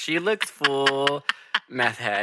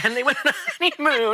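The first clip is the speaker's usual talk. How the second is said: laughing; 13 semitones higher, faster than usual. Real, not acted. Laughing at the joke.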